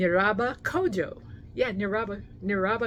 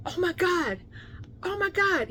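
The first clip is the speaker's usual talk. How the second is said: excited, high-pitched starstruck gasp of a quote; Finn fangirling; 11 semitones higher than usual. Retold or imitated. Friend spots his idol in the parking lot, mimicked fangirling. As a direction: mimic a giddy fan, voice shooting up. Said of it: "he starts fangirling and he's all excited".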